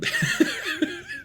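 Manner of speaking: high squealing laugh. Real, not acted. Reacting to the sim results, high laugh. Laughing at Joe Gibbs dominance.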